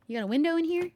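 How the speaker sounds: normal talking voice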